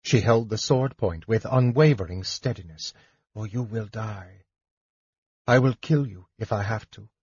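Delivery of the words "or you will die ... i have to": quiet, restrained threat; ordering threat. A narrator's reading. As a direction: warn him softly but firmly. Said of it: "Lorana ordered".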